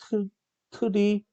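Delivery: halting, broken-up reading of an unfamiliar term; stumbling over the word. Real, not acted. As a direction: stumble into it syllable by syllable, with pauses. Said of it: "교수가 왜 떠듬떠듬거리냐면". (낯선 용어를 떠듬떠듬 읽기)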